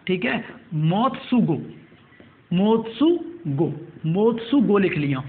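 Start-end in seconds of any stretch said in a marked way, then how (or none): 0.7-4.8 "मोत्सुगो" slowly, part by part; slow dictation. Shown as a demonstration. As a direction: stretch "मोत्सुगो" out for note-takers. Dictating an unfamiliar name.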